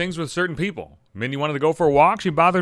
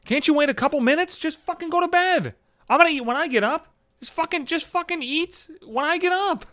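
high-pitched acted pleading with a cat; exasperated scolding; 12 semitones higher than usual. Owner woken by his hungry cat, acted exasperated scolding.

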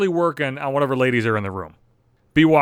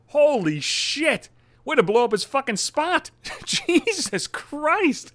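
laughing disbelief; 9 semitones higher, much slower than usual. Real, not acted. Laughing at the bad boy's comment.

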